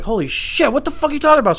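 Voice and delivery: high, loud startled outburst; mock alarmed shout; shocked. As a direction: react in disbelief. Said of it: "And I was like".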